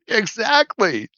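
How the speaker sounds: high, drawn-out laughing agreement; amused agreement. Real, not acted. Player agrees someone deserved it, laughing and high. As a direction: stretch it out high through a laugh.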